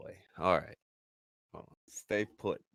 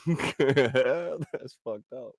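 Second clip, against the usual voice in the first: laughing; 4 semitones higher, 4 dB louder, much slower than usual. Real, not acted. Laughs at chat comment.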